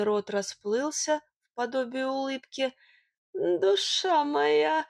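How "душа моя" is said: high, drawn-out lovey-dovey coo; syrupy character sweet-talk; tender cooing. A narrator's reading. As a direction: coo it slowly in a sweet little voice. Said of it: "непривычно нежным голоском заворковал он".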